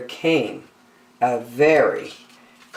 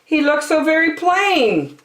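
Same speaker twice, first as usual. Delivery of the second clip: higher, puzzled lion; 14 semitones higher than usual. Acted. Lion hides in the bushes, puzzled and high. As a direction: wonder aloud, pitched up. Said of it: "Lion said".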